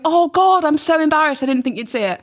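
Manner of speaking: troll caught out, mortified and flustered; embarrassed troll. Retold or imitated. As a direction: gush out a mortified apology. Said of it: "he replies going".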